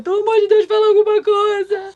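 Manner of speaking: high-pitched mock-crying plea; tearful pleading. Pregnant woman begging her silent husband to speak, mock tearful plea. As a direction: beg in a high, put-on tearful voice. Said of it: "eu comecei a chorar".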